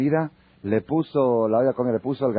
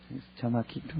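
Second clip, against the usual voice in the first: lightly voiced old lady, much softer; 11 dB quieter than usual. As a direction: drop to a small, hushed old-lady murmur. Old lady replying, very soft.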